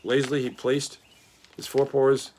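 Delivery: usual speaking voice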